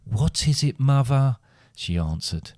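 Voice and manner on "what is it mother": lightly voiced daughter, higher pitch; girl answering mother. A narrator's reading. Girl answers her shouting mother.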